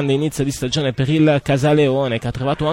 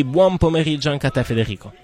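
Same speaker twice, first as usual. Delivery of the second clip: ordinary delivery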